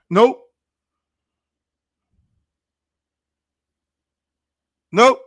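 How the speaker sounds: a loud, blunt "Nope" with a rising end; flat refusal. Retold or imitated. Coach refusing the kid's request.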